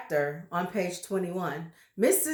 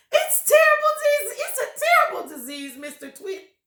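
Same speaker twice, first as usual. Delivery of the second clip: much higher, louder panic; panicked wife; 8 dB louder, faster than usual. Acted. Terrified wife to husband, high panicked cry. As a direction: gabble in terror.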